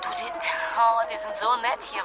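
ordinary talk, nothing marked

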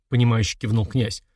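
usual speaking voice

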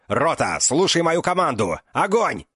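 high, loud frantic command; yelling in a strange voice. Acted. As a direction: bellow the order beside himself. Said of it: "заорал не своим голосом".

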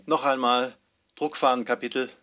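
ordinary talk

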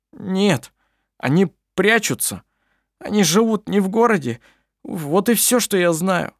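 high, worried man; a distressed brother explaining; upset simple man. A narrator's reading. Klampin answers, upset.

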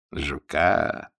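low, menacing question; dark lord menace; ominous voice. A narrator's reading. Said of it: "зловещим голосом переспросил Ярцев".

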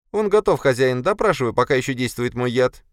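very high-pitched servant creature voice; high little spider urging its master on. Acted. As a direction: pipe up high, reporting to the master.

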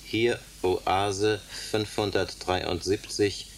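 slow, soft, word by word as if tapping it out; repeating a message to send by lamp, slow and quiet; slow spelled-out signalling. Acted. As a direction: spell it out slowly while signalling. Repeating message while morsing it.

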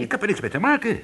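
high-pitched, insistent denial; worked-up, defensive retort; indignant protest. Acted. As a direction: protest your innocence to the boss. Employee protests his innocence in quarrel.

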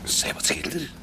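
whispered in bed; hushed so as not to wake; night whisper. Acted. (man fluistert 's nachts tegen zijn vrouw)

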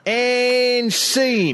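high, drawn-out "and scene"; mock director's call; scene-ending announcement. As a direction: close the skit with a theatrical flourish. Announces end of improv scene.

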